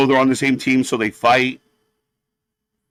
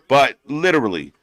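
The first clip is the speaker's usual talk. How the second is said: ordinary talk, nothing marked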